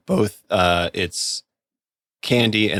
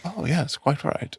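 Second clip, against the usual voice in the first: soft, higher-pitched imitation of dry agreement; very British, dry; 7 semitones higher, 6 dB quieter, slower than usual. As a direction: concede it quietly, in mock British understatement. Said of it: "it's so very British and, like, so dry".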